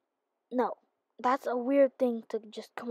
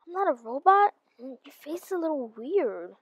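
normal talking voice

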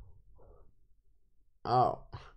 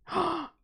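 sharp gasp; 13 semitones higher, 8 dB quieter than usual. Real, not acted. Shocked at two bans.

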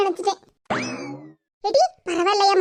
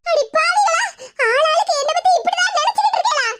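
high-pitched, wailing indignation; outraged, tearful-sounding cry; aghast protest; 7 semitones higher than usual. Acted. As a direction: protest as if betrayed by everyone. Protests again at their scheming.

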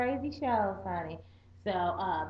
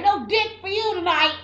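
loud teasing; 9 semitones higher, 9 dB louder than usual. Retold or imitated. Mocking line aimed at husband.

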